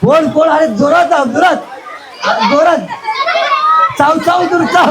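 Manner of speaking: high-pitched cheering on of players; excited urging. Real, not acted. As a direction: egg them on in high, repeated calls. Host urging players mid-game.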